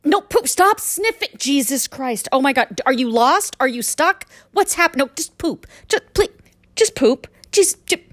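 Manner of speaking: high-pitched frantic begging at a dog; exasperated pleading. Acted. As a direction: plead desperately, losing patience.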